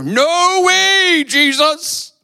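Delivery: high, loud, brash vow; boastful Peter. Retold or imitated. Peter boldly vowing loyalty to Jesus, high and loud. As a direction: Peter insisting he'd die first. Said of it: "But then Peter goes on, being Peter".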